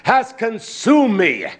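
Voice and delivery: preacher reciting scripture with fire; impassioned scripture reading. Acted. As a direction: cry out the verse with passion.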